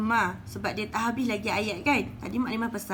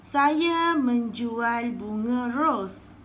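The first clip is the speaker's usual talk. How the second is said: slow example read; 3 dB louder, much slower than usual. Shown as a demonstration. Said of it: "Ni adalah contoh yang pertama".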